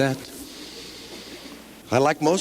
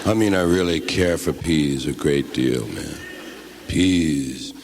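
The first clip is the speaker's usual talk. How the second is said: low, slow stoned drawl; stoned guy; 6 semitones lower, much slower than usual. Acted. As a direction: stretch every word, deep and dazed. Stoner character holds the bit.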